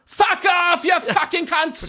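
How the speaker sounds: high, loud, drawn-out abuse; British heckling shout. Shown as a demonstration. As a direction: bellow it like a rowdy British hooligan. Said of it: "De roper på britisk".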